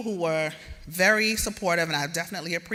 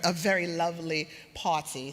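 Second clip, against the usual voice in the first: usual speaking voice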